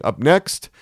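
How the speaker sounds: bright on-air patter, spinning up the song; radio DJ. A narrator's reading. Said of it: "the D J was saying".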